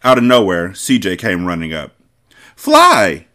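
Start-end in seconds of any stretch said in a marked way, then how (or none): 2.5-3.3 high, loud happy shout of a name; excited call. A narrator's reading. Boy calling out to Fly in excitement. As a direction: call out his name in delight. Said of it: "he called out in excitement".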